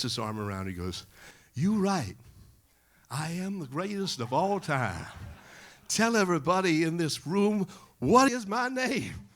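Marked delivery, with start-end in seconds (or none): 1.5-9.3 high, loud champion's proclamation; triumphant boxer addressing the whole room; Muhammad Ali. Acted. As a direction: build to a stretched, crowing question. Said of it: "Ali goes up to this old man".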